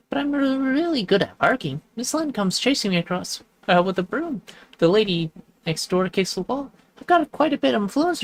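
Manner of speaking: dog chatting with a neighbor, high and playful; high playful character voices. Acted. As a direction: voice the little dog and friends up high and bright.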